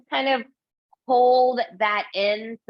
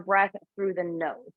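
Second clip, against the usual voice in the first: ordinary talk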